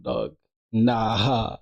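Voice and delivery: usual speaking voice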